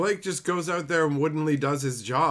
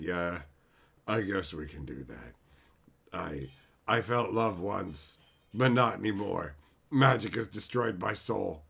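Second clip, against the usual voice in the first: defeated Blake; 6 semitones lower, 3 dB quieter than usual. Retold or imitated. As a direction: imitate a PR man whose spirit is broken. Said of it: "so Blake's like".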